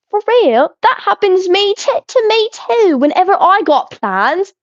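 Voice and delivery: high, put-on British reading voice; attempted British accent. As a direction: read it out in a hammed-up British accent. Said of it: "I tried to read, like".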